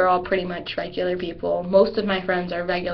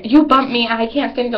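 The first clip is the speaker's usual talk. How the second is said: louder, higher mock snap; rude accusation; 4 semitones higher, 5 dB louder than usual. Cheerleader showing a rude reply to a stranger, loud mock snap. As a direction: snap at her like a rude girl. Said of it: "if I was rude to her".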